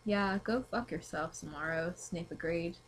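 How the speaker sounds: ordinary talk, nothing marked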